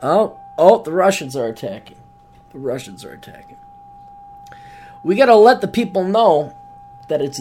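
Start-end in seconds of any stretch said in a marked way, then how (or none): 2.5-3.6 much softer and fast; quick quiet aside. Shown as a demonstration. Joking aside to the audience, soft and fast. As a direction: toss it off under your breath. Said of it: "repeats the line two ways".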